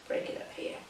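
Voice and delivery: ordinary talk, nothing marked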